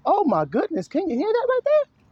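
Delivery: high-pitched excited outburst; excited exclamation. Real, not acted. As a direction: shoot up into a high, amazed squeal. Preacher exclaims over his own point.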